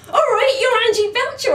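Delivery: high, loud gushing fan voice; overexcited fan. Retold or imitated. Admin assistant Enid gushes at Angie on the table, high and loud. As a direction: shriek it like a starstruck fan. Excited nurse grabs her hand.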